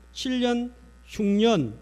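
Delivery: normal talking voice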